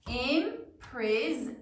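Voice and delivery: imprison said slowly, as it is spelled; spelling voice; said as written. Shown as a demonstration. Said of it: "Now say it as you write it".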